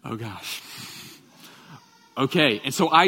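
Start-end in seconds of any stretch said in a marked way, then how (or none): 0.0-0.6 much softer low worried gasp; nervous mutter. Retold or imitated. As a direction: say it under your breath, scared. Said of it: "And I'm like".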